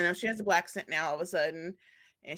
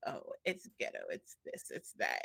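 mock whisper; 3 semitones lower, 10 dB quieter than usual. Mocking outsiders, unvoiced.

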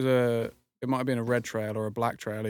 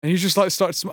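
ordinary talk, nothing marked

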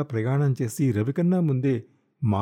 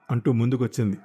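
usual speaking voice

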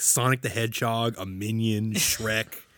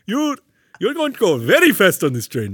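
mock Middle Eastern accent; 9 semitones higher, 9 dB louder than usual. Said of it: "use his real accent".

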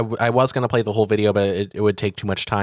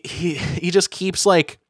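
ordinary talk, nothing marked

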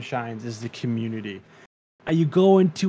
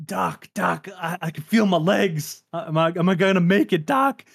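high, fast, frantic wounded soldier; panicking gamer; 6 semitones higher than usual. Retold or imitated. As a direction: beg the medic in panic. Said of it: "he's like".

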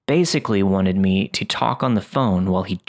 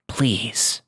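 creepy raspy voice; 3 dB quieter than usual. Retold or imitated. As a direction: rasp the word out slowly, unsettling. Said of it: "in a creepy, raspy voice".

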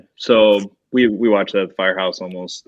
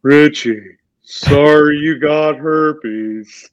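very slow, drawn-out puppet voice, pitched up; Matt Damon; 5 semitones higher, 3 dB louder, much slower than usual. Pretend. Guest reads the banner on a challenge, slow puppet voice. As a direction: stretch every word out like a simple movie puppet. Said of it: "read in your best Matt Damon voice".

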